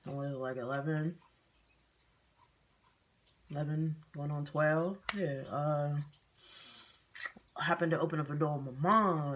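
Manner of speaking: ordinary talk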